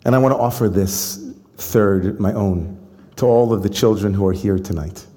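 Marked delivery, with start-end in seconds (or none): none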